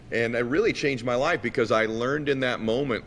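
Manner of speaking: ordinary talk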